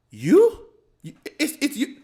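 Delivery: the doctor suddenly recognizing her; retold surprised recognition. The doctor spots her in the waiting room, surprised. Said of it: "I'm not gonna do the accent".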